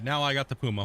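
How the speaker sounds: ordinary talk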